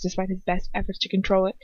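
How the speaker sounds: ordinary delivery, nothing marked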